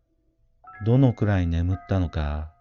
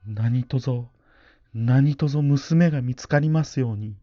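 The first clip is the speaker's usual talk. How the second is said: higher, earnest pleading prayer; desperate prayer; 7 semitones higher than usual. Acted. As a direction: beg the Buddha with all your heart. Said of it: "一心不乱に祈り続けた".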